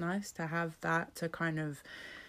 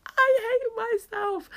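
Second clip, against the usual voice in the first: much higher, slow, put-on comic voice; mock self-loathing; 7 dB louder, slower than usual. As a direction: stretch it out in a silly whiny voice. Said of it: "And then sometimes I'm like".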